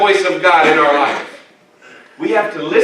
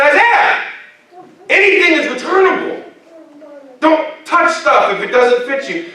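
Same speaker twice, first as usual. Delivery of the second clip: mock scolding; 9 semitones higher than usual. Scolding son Isaiah.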